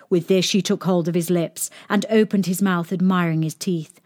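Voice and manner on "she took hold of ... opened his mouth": plain reading voice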